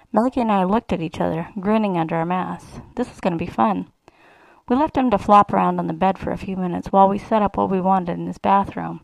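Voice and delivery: ordinary delivery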